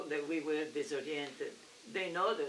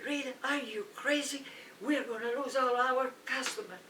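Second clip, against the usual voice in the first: higher, alarmed manager voice; 7 semitones higher than usual. Retold or imitated. Manager scolds Rita, raised alarmed voice. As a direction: raise pitch as the shocked boss.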